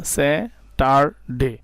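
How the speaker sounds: স্যাটারডে drawn out very slowly in three parts; teacher splitting স্যাটারডে; slow syllable split. Shown as a demonstration. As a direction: sound out স্যাটারডে in three beats. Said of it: "তিনটা অংশে বিভক্ত করা গেছে".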